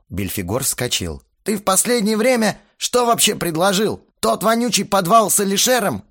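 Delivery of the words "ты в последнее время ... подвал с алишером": angry shout. Acted. Band members quarrel, shouting.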